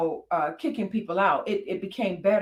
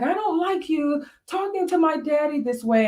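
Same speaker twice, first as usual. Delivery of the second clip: put-on little girl scolding; 7 semitones higher than usual. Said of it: "she was like".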